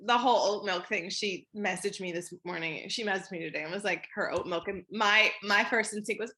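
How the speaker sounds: normal talking voice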